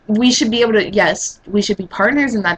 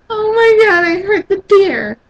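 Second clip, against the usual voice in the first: high, wailing mock panic; freaking out; 11 semitones higher, 4 dB louder than usual. Mocking a man upset after shooting a deer, freaking out. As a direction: wail it like a hammed-up wimp. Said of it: "and not freak out because".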